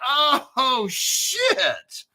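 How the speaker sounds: high, drawn-out "oh shit" of disbelief; mock-astonished outburst; amazed reaction. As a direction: imitate a stunned viewer, pitch shooting up then falling. Acts out the viewers' amazed reaction.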